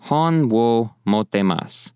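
"Hon wo mottemasu" slow and clear; Japanese model phrase. Shown as a demonstration. Teacher demonstrating the phrase slowly. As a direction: repeat it slowly for learners. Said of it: "I have a book".

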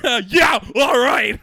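loud, high dopey shrugging agreement; Pete Davidson impression. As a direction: mumble along like a confused comic. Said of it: "classic Pete Davidson, like, bit".

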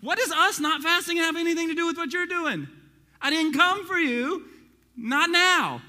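high, loud rebuke; Jesus rebuking Pharisees. Acted. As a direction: push back hard at the Pharisees.

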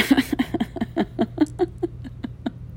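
breathy, embarrassed little laugh; nervous laugh. Real, not acted. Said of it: "it's out of nervous laughter".

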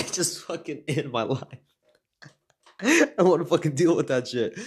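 can't stop laughing at the memory. Real, not acted. Venting about the job, laughing throughout.

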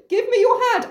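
high, melodramatic appeal; desperate plea. Acted. Blind Oedipus to his dragged-off daughter, high desperate plea. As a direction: reach for her with a high cry.

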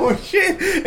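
high, softened startled cry; mock shock. Comic acting out a woman hit by a basketball, mock shock. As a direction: imitate a startled victim in a high, small voice.

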